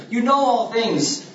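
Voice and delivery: high, imploring cry; heart cry. Acted. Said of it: "same heart cry that's coming from Peter".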